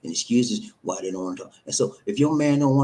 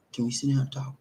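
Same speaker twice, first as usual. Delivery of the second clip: calm wife; 2 semitones higher, 5 dB quieter, faster than usual. Acted. Role-play of the wife asking calmly.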